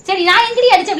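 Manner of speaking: loud, high-pitched denial; indignant protest. Real, not acted. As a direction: protest loudly, pitch jumping up. Husband protests accusation of hitting. (சத்தமாக மறுத்து வாதாடுதல்)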